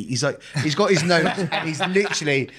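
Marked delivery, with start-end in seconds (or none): none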